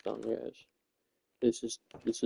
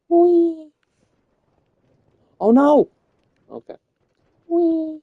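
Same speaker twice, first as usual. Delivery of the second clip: much higher squealing wees and panicked nos; thrilled squeals; 12 semitones higher, 7 dB louder, slower than usual. Real, not acted. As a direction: shriek like on a rollercoaster. Riding a wild course downhill.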